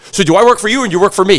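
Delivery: high, loud, fast boss's challenge; confronting grandfather. Retold or imitated. Grandfather to delivery guy, settling who is boss, confronting. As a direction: fire off the pointed question as the boss. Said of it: "He goes".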